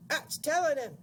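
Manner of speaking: loud, high crowing screech; shrieking. A narrator's reading. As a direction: cheer the beating on shrilly. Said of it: "shrieked Missus Pratchett".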